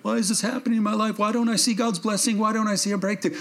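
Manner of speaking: high, hurried imitation of a complainer; mock complaining. Preacher voices congregant questioning lack of blessing, mock complaining.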